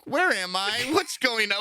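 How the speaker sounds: high, lost and bewildered; mock confused. Friend mimicking Sam's high, bewildered waking. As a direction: play dazed and lost. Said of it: "you were like".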